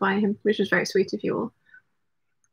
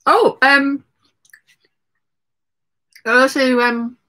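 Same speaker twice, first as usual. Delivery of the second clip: mouth full; 3 semitones higher, 5 dB louder, slower than usual. Real, not acted. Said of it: "I shouldn't talk with my mouth full".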